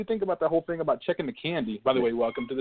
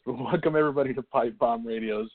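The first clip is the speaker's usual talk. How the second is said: usual speaking voice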